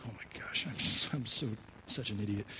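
hushed whisper to yourself; embarrassed whispered thought, hushed; whispered self-reproach. Retold or imitated. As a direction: breathe it out like a private groan of embarrassment. Inner panic after she leaves.

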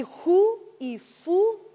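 slow, drawn-out "ju y fu"; H versus F demo. Shown as a demonstration. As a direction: contrast breath-h "ju" with lip-f "fu". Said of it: "son escritos igual".

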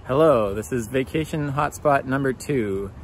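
big booming host announcement; loud show intro. Pretend. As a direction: call it out like a travel show host. Mock show intro, number two.

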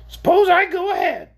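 very high, loud creature voice; shrill orc. A narrator's reading. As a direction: pipe up shrilly as a little creature. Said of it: "his shrill voice".

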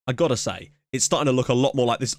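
normal talking voice

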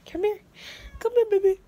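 high sweet coaxing call. Real, not acted. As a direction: sweet-talk the kitty over. Coaxing a cat.

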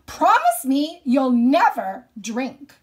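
loud, slow, fierce mother's order; stern command. Acted. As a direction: bark it as a furious mother, drawn out. Mother demands promise.

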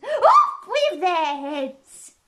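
high, loud, drawn-out bellow; furious shouted order. A narrator's reading. Queen condemns the gardeners in a furious shout. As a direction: bellow it slowly as a death sentence.

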